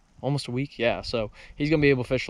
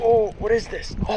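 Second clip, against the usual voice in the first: surprised; 10 semitones higher than usual. Real, not acted. Sudden strike while fishing.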